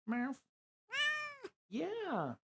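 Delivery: high, soft sing-song pet talk. Real, not acted. Talking to pet again.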